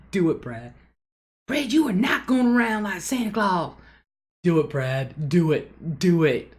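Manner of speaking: loud, high teasing cheer of do it, Brad; cheering him on. Retold or imitated. Teasing her friend's husband, a loud high cheer. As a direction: egg him on like a rowdy friend.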